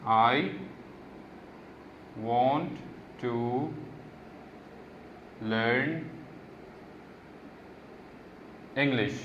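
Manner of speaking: slow dictation, one word at a time with pauses. Shown as a demonstration. Teacher dictating a homework example sentence word by word. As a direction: dictate each word separately. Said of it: "write ten sentences using".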